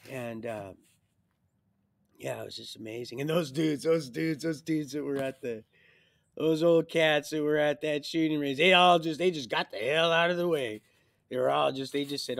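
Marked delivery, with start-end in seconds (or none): 3.2-12.4 loud, raised, emphatic storytelling; excited retelling. Real, not acted. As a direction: build to a loud peak, words tumbling over repeats. Animated retelling of men fleeing.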